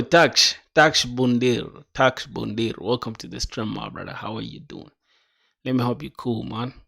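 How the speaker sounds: ordinary talk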